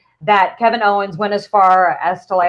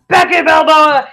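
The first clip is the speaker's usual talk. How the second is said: slow, loud ring-announcer style; announcer call; 7 semitones higher, 5 dB louder than usual. Pretend. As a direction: call the name into the arena. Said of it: "repeating the nickname".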